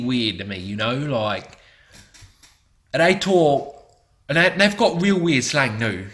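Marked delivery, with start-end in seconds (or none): 2.9-6.1 higher mock English accent. Describing English slang, still in a higher mock English accent. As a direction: keep the put-on English voice. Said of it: "they talk like this".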